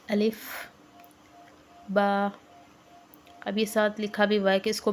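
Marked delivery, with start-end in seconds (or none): none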